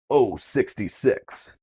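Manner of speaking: slow drawn-out number call; bingo caller. Acted. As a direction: stretch the number out, bingo-hall style.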